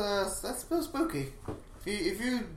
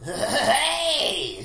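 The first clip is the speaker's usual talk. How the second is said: Krusty the Clown voice; 5 semitones higher, 7 dB louder than usual. Retold or imitated. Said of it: "that's even more crusty".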